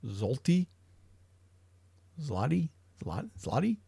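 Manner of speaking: "Zloty" tried with a rising, questioning tune; sounding out "Zloty" unsure of it; unsure pronunciation. Real, not acted. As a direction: test out "Zloty" haltingly, like a question. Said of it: "What the hell is that word?".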